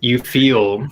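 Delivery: ordinary talk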